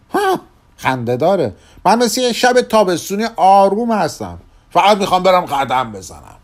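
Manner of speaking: loud, high, defensive protest; irritable, on edge. A narrator's reading. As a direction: insist on being calm while clearly rattled. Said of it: "چی شده مثل سگ عصبانی هستی".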